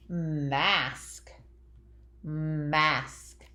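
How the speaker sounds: MASK drawn out, lingering on m and the short A; sounding out slowly. Shown as a demonstration. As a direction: stretch mask slowly so each sound shows. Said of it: "Listen to me say the word".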